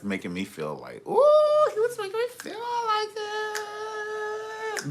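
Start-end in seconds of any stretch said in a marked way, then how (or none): 1.1-4.9 very high, drawn-out mock wail; exaggerated wail. Partner mimicking how the boyfriend made them feel, exaggerated wail. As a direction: stretch it out in a hammed-up high squeal. Hammed-up repeat of the feeling.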